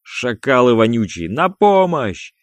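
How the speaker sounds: loud, drawn-out cry climbing very high; desperate high yell; yelling for help. A narrator's reading. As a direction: scream for rescue, stretching the words. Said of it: "завопил, что есть силы Чеков".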